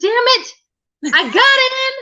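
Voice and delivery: high, fast angry mother; angry mom voice. Retold or imitated. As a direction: rant it like a fed-up mom.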